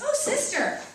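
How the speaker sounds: a young English lad's voice, badly faked British accent. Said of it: "this is my terrible British accent".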